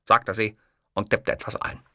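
ordinary delivery, nothing marked

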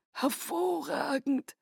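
high, slow, soft whisper of delight. Acted. Aro whispers in delight at Renesmee's touch, soft and slow. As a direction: breathe it out in wonder. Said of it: "flüsterte er".

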